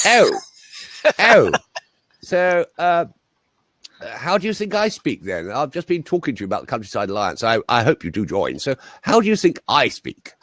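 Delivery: high, drawn posh English "oh"; posh English accent. Retold or imitated. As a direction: imitate a taken-aback upper-class Englishman. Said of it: "Bosh git".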